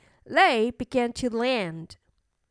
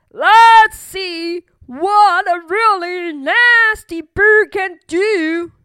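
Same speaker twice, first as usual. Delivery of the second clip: loud, slow, raised storybook voice; booming challenge; 6 semitones higher, 8 dB louder than usual. Acted. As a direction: draw out the threat at full volume. Big bird's voice challenges the bully.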